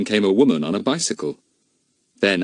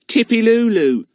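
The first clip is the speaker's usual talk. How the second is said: put-on young boy; 5 dB louder than usual. A narrator's reading. Telegraph boy corrects.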